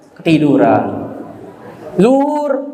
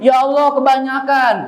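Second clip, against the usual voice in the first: mock complaint; 7 semitones higher than usual. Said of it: "manusia ini suka ngeluh".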